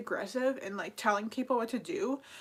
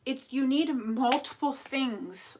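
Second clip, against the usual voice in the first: ordinary delivery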